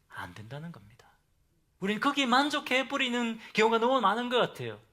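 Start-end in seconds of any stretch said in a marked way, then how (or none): 0.1-1.1 breathy stage whisper for emphasis; preacher's hushed warning. Real, not acted. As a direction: drop to a whisper to drive it home. Preacher drops to emphatic hush.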